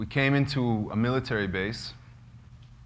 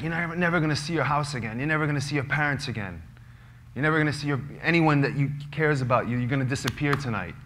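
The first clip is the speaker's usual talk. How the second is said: fast, higher taunting with a hammer on never; mock police taunt; 5 semitones higher, faster than usual. Police taunting the detainees, fast and higher. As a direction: rub in that they'll never go home. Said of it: "they're like".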